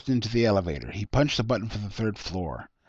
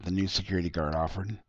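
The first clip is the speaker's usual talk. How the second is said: plain reading voice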